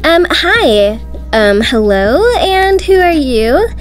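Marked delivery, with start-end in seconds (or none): none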